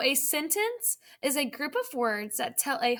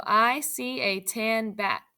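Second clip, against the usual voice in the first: put-on slow, word by word for young readers; slow read-aloud; 3 semitones lower, slower than usual. Shown as a demonstration. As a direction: read it out slowly so kids can follow. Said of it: "I'm going to read them to you".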